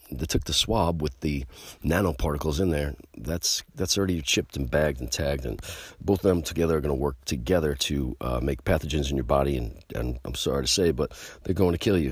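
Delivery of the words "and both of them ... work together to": ordinary talk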